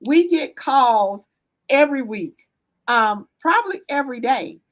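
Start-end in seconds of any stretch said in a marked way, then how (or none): none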